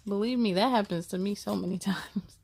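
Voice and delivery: plain reading voice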